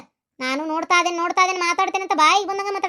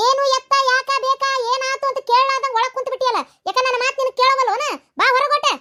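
higher, sharp scolding call; angry calling; 4 semitones higher than usual. Acted. Mother scolding daughter to come outside, angry and raised. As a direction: demand she come out at once.

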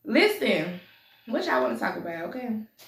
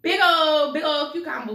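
excited; 5 semitones higher, 6 dB louder than usual. Real, not acted. Showing viewers the big cucumber, loud excited hype. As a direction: belt it out with glee.

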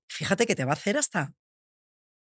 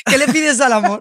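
real laughter, louder and higher; amused, raised-voice insistence; laughing question; 5 semitones higher, 8 dB louder than usual. Real, not acted. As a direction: toss the question back through laughter. Laughing as they deflect the question.

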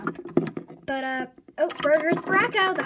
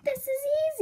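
high cheery voice; 11 semitones higher than usual. Acted. Sunburst accepting quiet dare.

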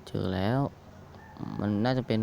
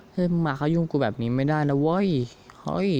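mock angry yell; 5 semitones higher than usual. Real, not acted. Yelling at attacking wolf in game.

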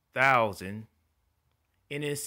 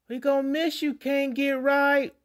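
inmate farewell; 7 dB louder than usual. Retold or imitated. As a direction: stretch it out mocking like an old con.

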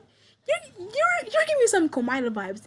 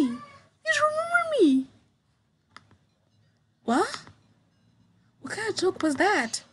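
put-on high, louder girl voice in disbelief; Sarah, shocked girl; 7 semitones higher, 5 dB louder than usual. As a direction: plead with a friend who forgot you. Voices Sarah confronting Joe.

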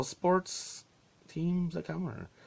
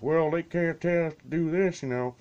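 mock dad; 7 semitones higher, 5 dB louder than usual. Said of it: "The dad's like".

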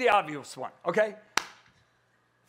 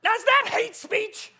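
passionate; 8 semitones higher than usual. Real, not acted. Preacher defending warnings as love, passionately. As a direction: plead with fire in the voice. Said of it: "You're pretty passionate right now".

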